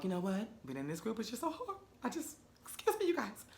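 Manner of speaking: high, soft, faux-teary quaver with a laugh; mock tearful exit. Mocking Oliver's dramatic exit from the group, soft fake-teary voice. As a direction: ham up his wounded walk-off. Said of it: "Oliver was like".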